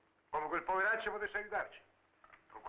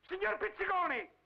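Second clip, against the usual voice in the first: calling out. Acted. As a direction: shout the name into the dead phone. Caller hangs up on him.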